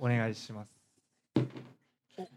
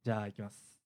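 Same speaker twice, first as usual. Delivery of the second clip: usual speaking voice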